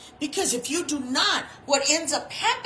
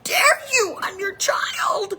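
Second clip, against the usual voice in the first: put-on indignant child; 8 semitones higher than usual. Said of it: "I just would look at her like".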